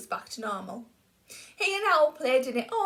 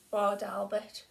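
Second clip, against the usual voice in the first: ordinary delivery, nothing marked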